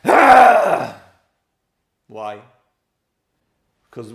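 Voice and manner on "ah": loud, high outburst; acted high-pitched yell of reaction; exasperated cry. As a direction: cry out in frustration. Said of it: "how did she react?".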